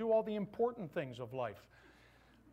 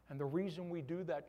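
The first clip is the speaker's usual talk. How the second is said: normal talking voice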